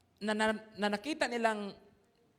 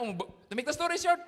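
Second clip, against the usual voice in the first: usual speaking voice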